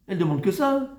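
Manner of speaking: ordinary talk, nothing marked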